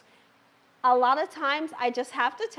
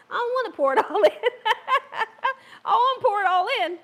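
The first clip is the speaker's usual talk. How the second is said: quick, laughing through the words; 7 semitones higher, faster than usual. Real, not acted. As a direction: giggle as you say it. Laughing while saying it.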